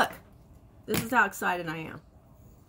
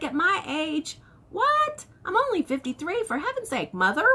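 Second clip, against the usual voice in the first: mock outrage; 8 semitones higher than usual. Mock indignation at mom.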